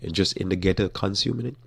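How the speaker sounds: ordinary talk, nothing marked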